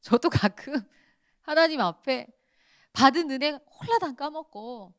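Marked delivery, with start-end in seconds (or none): none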